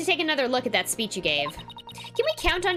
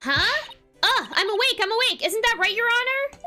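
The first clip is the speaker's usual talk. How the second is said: high, startled insisting she is awake; flustered protest; 11 semitones higher, 3 dB louder than usual. Acted. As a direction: jolt awake and protest too eagerly. Caught sleeping, insists awake.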